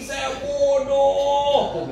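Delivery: high, long-drawn cry to God; tearful wail. Retold or imitated. Preacher retells crying out to God after hearing the warning, tearful wail. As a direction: imitate a tearful wail, stretching the last words. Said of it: "saya nangis".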